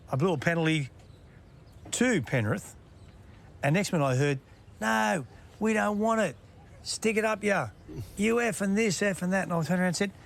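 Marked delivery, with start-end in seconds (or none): 4.8-9.4 imitating abusive coach. Angry coach yells at the referee, in a mild mock yell. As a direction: mimic the coach's abuse, half-hearted.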